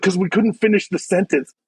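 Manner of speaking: ordinary delivery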